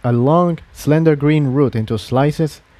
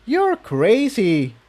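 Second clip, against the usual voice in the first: higher mare voice; 10 semitones higher, much slower than usual. A narrator's reading. Incendia voiced by narrator.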